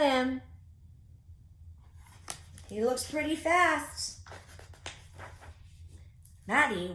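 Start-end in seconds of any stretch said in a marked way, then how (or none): none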